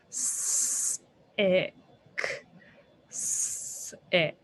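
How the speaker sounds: "Sick" whispered, stretching the s; sounding out words. Shown as a demonstration. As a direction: hiss out the s of "Sick". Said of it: "Ooh, I hear a s".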